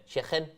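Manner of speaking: "Chicken" with a raspy back-of-throat ch; Scouse throaty CH. Shown as a demonstration. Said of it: "everything's with a ch".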